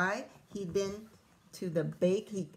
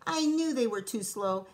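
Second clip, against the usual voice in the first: triumphant laugh; 2 semitones higher, 5 dB louder than usual. Acted. Cookie gloats in the backpack with a loud laugh. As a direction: crow with delight. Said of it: "Piggy laughed inside the backpack".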